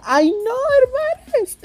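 dramatic gasp. Acted. [name] reads the scandal to listeners, mock shocked. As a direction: shriek it like scandalized gossip. Dramatic exclamation reading story.

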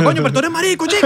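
high, mock fatherly jab in a put-on voice; imitating his dad. Friend imitates his father answering him, high mock voice. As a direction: hams up the dad's teasing reply, pitched well up. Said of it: "Y el papá le responde".

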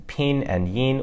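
"pin" and "yin" each held high and flat; "pin" and "yin" in Mandarin first tone, high and steady. Shown as a demonstration. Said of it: "to indicate the first tone".

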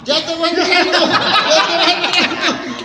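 high, loud port crier's call amid laughter; drawn-out mock dockside shout; hollered announcement. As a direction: holler it for the punchline. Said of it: "Porque yo gritaba".

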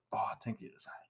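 soft whisper; hushed ASMR-style whisper. Shown as a demonstration. Whispered setup, ASMR mood.

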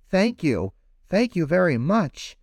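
little fish thrilled with gift; delighted thanks. Acted. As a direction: bubble over with gratitude.

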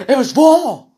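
very high, very loud yell; mock battle cry. Podcaster capping his chef tirade, hammed-up scream. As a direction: bellow it in over-the-top outrage.

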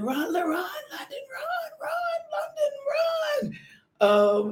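high-pitched screaming cheer, voice cracking; aunt screaming her niece down the track; retold yelling. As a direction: shriek for her niece to run. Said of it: "Auntie was yelling".

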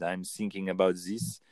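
put-on thinking said as sinking in a German or French accent; light German or French accent, th turned to s; a coach imitating a German or French speaker without th. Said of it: "German, or same in French".

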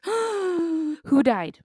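high alarmed who died; mock terrified mom. Mom startled awake by child, high panicked cry. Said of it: "she goes, gasps".

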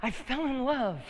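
high, mock-helpless falling cry; hapless victim. Pastor mocks falling in love as tripping, haplessly high. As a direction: wail it like someone tumbling into a ditch. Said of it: "sounds like some poor victim".

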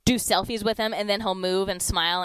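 ordinary delivery

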